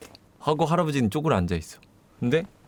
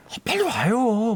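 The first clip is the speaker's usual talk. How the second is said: high, drawn-out mock plea; urgent calling; 8 semitones higher, slower than usual. Cop calling the old man over, urgent. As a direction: imitate a cop impatiently calling for backup.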